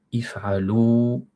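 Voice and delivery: if'alu said very slowly and clearly; drawn-out teacher model of if'alu; slow Arabic model word; model pronunciation. Shown as a demonstration. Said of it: "Vous, antum".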